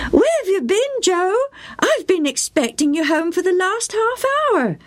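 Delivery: high, fretting mother's voice; anxious mum calling; worried mother. A narrator's reading. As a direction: call out to the late boy. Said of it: "his mother called".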